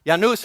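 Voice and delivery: Janus said YAH-noos, with a Y sound; Latin pronunciation. Shown as a demonstration. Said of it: "I looked up the correct pronunciation".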